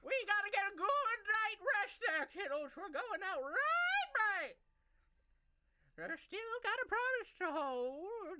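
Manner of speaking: put-on high, loud, slow old lady; old rabbit lady voice. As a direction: croak it out like an excitable old hare. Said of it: "the very old Harengon hops onto the table".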